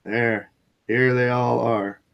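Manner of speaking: slow drone on one level note; mock monotone. Presenting the proofs to viewers as a joke, slow monotone. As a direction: drag it out without any inflection.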